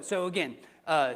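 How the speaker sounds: usual speaking voice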